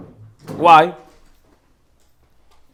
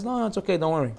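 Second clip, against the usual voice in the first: unbothered reassurance; 9 dB quieter, faster than usual. Retold or imitated. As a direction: softly brush it off, much quieter. Friend brushes off being mocked.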